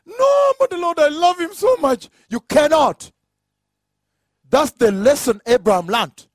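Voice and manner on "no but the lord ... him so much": high, wailing objection; acted pleading protest. Abraham arguing with the Lord for his son, pleading protest. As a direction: plead at a much higher pitch, drawing out the "No".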